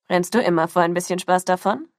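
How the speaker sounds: low-voiced thug's taunt; deep, mocking question lifting at the end. A narrator's reading. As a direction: tease her menacingly in a low voice. Thug taunts the cornered girl.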